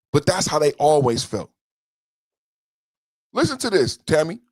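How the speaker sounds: normal talking voice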